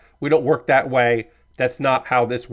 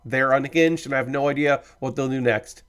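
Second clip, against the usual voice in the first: normal talking voice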